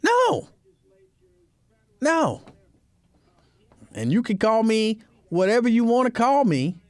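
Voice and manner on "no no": high-pitched, adamant no; emphatic no. Real, not acted. Refusing the man's pedicure, emphatic high-pitched no.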